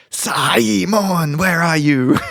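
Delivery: high calling Sai-mon, where are you. Retold or imitated. Boss hunting for her employee, high call. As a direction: call him out like an impatient boss. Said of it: "that extra syllable a lot more distinctly".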